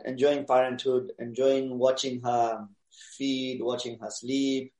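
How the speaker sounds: ordinary delivery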